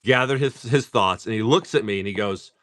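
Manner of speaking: ordinary talk, nothing marked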